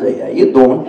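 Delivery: a lightly voiced low, flirty Joey line; a hint of a smooth pickup line; Joey from Friends. Husband flirts with his wife in a light Joey voice. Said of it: "like, the Joey from Friends".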